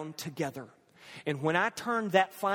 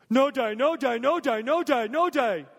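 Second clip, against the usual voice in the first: put-on higher, louder little-kid whine; a child refusing his dad again and again; scared toddler pleading; 7 semitones higher, 5 dB louder than usual. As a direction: beg like a frightened three-year-old.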